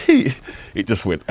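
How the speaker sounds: laughing out loud; bursting into laughter. Real, not acted. Recalling crowd reaction.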